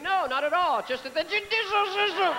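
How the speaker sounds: hammed-up drunk denial; drunk-sounding. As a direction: slur judicial while denying it. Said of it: "without sounding drunk".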